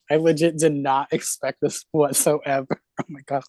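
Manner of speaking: usual speaking voice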